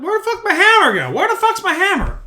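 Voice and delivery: half-hearted annoyed grumble as he hunts; frustrated search. Retold or imitated. Searching for the lost hammer.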